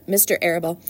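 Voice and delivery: plain reading voice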